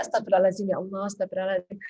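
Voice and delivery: low, soft quick murmured prayer; quiet istighfar. Shown as a demonstration. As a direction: murmur it under your breath. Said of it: "kita istighfar aja". (istighfar pelan dan lirih)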